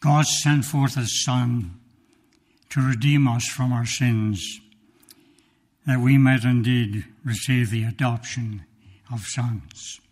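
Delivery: loud, slow, weighty proclamation; full-force preaching. Acted. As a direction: lean into each word like a pulpit climax. Preaching climax, louder and slower.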